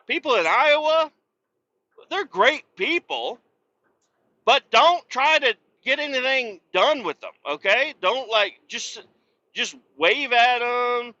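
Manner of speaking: high, loud mock-sincere praise. Real, not acted. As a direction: gush sarcastically about Iowa people. Mocking Iowa folks, raised voice.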